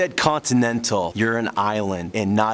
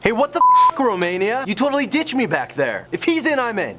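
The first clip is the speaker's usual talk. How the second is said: indignant outburst; 10 semitones higher than usual. Acted. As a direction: shout as if betrayed. Another country protests in a skit.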